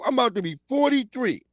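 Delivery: ordinary talk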